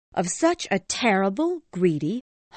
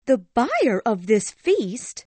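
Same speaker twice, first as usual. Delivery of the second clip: higher, slow indignant echo, "buyer" hit hard; angry cry; 13 semitones higher, 5 dB louder, slower than usual. A narrator's reading. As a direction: fling the words back in disbelief. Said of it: "cried Missus Cratchit angrily".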